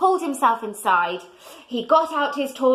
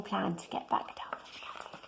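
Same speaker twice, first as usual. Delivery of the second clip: whisper; 4 semitones lower, 15 dB quieter than usual. Real, not acted. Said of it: "whispered aside to listener".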